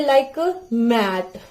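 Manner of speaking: "मैट" drawn out slowly, m-a-t; slow clear example. Shown as a demonstration. Teacher giving "मैट" as a slow example word. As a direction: stretch "मैट" for the child. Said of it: "कोई भी word ले लीजिए".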